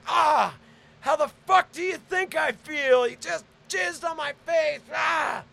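high, loud indignant yelling; acted high-pitched fury; outraged shouting. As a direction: yell back furious, bookended with cries. Acted furious answer on the recording.

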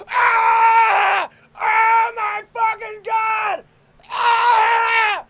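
full-force high screams of disgust; shrieked, drawn-out horror; horrified screaming. Acted. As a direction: scream it as if waking to something revolting. Acted horror on waking up.

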